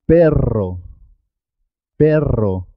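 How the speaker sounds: perro with a rolled rr; marked double R. Shown as a demonstration. Teacher models perro for learners, the R marked and rolled. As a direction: trill the R in perro clearly. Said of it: "Es importante marcar".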